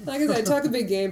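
usual speaking voice

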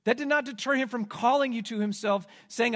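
ordinary talk

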